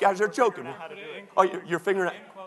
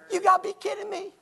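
high, quick mock disbelief; incredulous church member; 11 semitones higher, faster than usual. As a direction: balk at it like an offended church member. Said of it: "that's what the average church member thinks".

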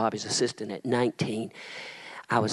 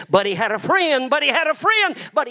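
high, loud preacher's shout; 8 dB louder than usual. Acted. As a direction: hurl the refrain at the crowd. Building the refrain to a climax.

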